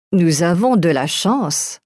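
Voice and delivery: normal talking voice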